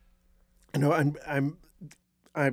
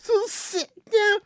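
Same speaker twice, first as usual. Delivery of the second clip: laughing; slower than usual. Real, not acted. Laughing fit while speaking.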